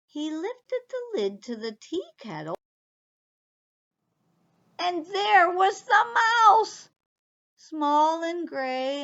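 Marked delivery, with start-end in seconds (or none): 4.8-9.0 higher, louder cry at the mouse; spring the surprise on listeners; surprised reveal. A narrator's reading.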